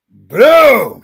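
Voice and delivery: loud, high shouted boom. Retold or imitated. Retelling the slam in the fight, shouted boom. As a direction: punch the impact out.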